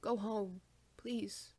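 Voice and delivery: ordinary delivery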